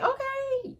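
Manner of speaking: very high-pitched, put-on acknowledgment; exaggerated high little response; unbothered reaction. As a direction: squeak it out as a mock reaction. Retold reaction to girlfriend news.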